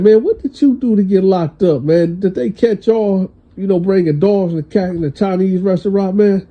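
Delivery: high, joking needle at a cellmate; teasing jab. Retold or imitated. To his Korean cellmate, a high teasing jab.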